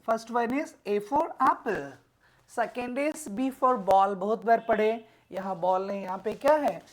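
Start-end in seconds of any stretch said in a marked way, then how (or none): none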